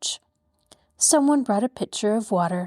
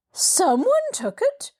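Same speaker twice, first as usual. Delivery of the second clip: shocked question; 12 semitones higher, slower than usual. A narrator's reading. Lady learns bag was taken.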